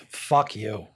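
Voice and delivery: put-on low, angry Boston accent. Boston drinker just being like that, Boston accent. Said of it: "that accent comes from you".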